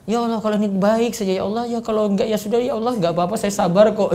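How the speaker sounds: quick, soft, resigned plea; non-committal prayer. Shown as a demonstration. Ustaz mocking a non-committal prayer, quick and soft. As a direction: say it like you'll settle for anything. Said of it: "Itu doa enggak tegas".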